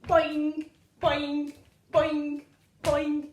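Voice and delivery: short, punchy, even boing noises; bouncing boings. Pretend. Kangaroo jumps with children, punchy springy boings. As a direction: make a springy sound on each jump.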